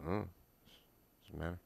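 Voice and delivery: barely audible sulky mumble; mumbled kid answer. Retold or imitated.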